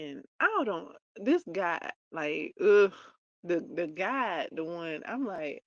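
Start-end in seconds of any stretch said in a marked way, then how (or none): 2.6-2.9 loud grunt of exasperation; short, loud groan of annoyance; exasperated ugh. Real, not acted. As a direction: let out a loud, fed-up ugh. Exasperated with the guide character.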